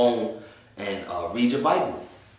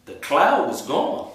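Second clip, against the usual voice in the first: amazed; 8 semitones higher, slower than usual. Retold or imitated. Amazed at cloud vanishing.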